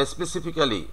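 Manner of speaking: "specifically" as spesi-fi-KAA-li; every written syllable of "specifically" sounded; local "specifically". Shown as a demonstration. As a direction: say "specifically" with a full "kaa" before "li". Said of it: "আমরা কিন্তু এটাই বলে থাকি".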